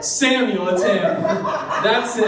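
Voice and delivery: hammed-up thrilled cry, breaking into laughter; excited recognition. Saul recognizing Samuel from the witch's description, hammed-up excited.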